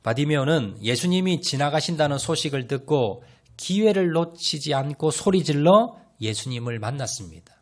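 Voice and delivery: ordinary talk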